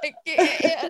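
high, loud laugh-cry; laughing mock cry. Student breaking down in acting class, high and laughing. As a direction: pretend to crack up crying. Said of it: "and you're just like".

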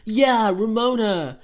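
put-on high, slow slurred drunk voice; whiny tipsy complaining. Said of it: "in her drunk voice going".

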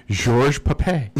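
mock-French accent, slowly drawn out; French pronunciation. Shown as a demonstration. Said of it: "just make it French".

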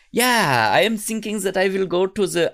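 high, put-on German accent; comic German-accented character voice. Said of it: "I don't know why he has a German accent".

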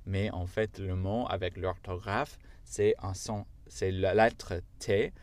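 ordinary talk

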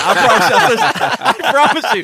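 high-pitched laughing. Real, not acted. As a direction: squeal it out through laughter. Laughing hard mid-story.

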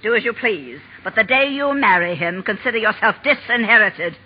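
usual speaking voice

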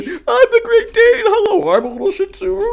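very high, playful little toy dog voices; high pretend voices of toy dogs; toy figurine voices. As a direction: play with the figurines and squeak out their hellos. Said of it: "figurines going".

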